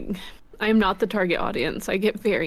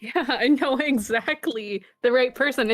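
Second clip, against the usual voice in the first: usual speaking voice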